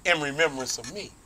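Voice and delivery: soft, solemn Jesus; Jesus offering the bread to his friends, softly and solemnly; hushed Jesus. Retold or imitated.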